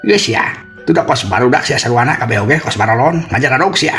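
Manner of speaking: high-pitched irritated telling-off; cross scolding. Acted. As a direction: scold the whole lot of them in a high voice. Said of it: "Bari jeung ambek".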